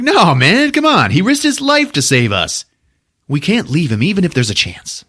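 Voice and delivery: high, impassioned mock plea; hammed-up heroic insistence; pleading hero. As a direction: argue urgently against leaving a friend.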